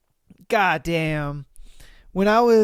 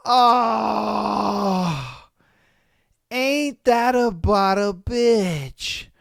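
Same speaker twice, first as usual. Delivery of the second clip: pained exclamation; much slower than usual. Real, not acted.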